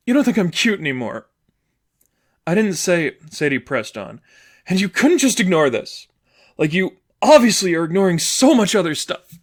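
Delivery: put-on wounded girlfriend cutting her off; hurt girlfriend. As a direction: press on over her reply. Sadie's voice, hurt.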